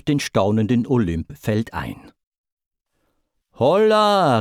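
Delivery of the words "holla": high, loud hail; long, ringing holler; hearty call. A narrator's reading. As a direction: call down from a window. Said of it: "rief lachend aus dem Fenster Zeus".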